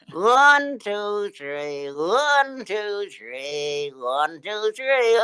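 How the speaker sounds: put-on high girly puppet voice; girl voice. Shown as a demonstration. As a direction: squeak the count like a little girl. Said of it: "I'm gonna talk like a girl".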